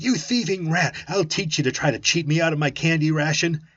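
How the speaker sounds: furious high, loud tirade; angry yelling. Acted. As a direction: rave at him, raging. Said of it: "He called me a million names".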